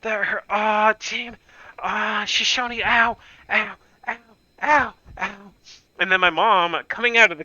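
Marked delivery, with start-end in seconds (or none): 0.0-5.5 high, pained ows; acting out stumbling up the stairs in pain; retold whimpering in pain. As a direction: whimper through a hurt hand. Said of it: "trip up the stairs going like".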